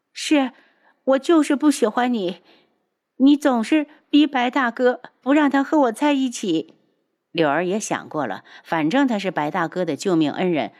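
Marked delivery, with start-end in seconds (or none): 0.1-6.7 put-on higher, louder sulky girlish voice; petulant young girl. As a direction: whine like a spoiled young girl. Liu'er's voice.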